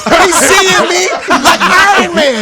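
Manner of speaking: slow, high, laughing tease. Acted. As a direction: drag it out while laughing. Teasing about Google glasses.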